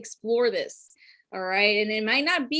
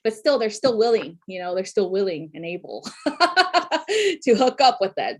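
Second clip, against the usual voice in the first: ordinary talk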